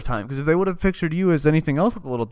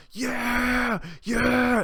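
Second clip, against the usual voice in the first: high, drawn-out excited cheer; stretched high-pitched party yell; mock crowd shouting; 10 semitones higher than usual. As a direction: cheer like a hyped-up crowd without full volume. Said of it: "Everyone starts shouting".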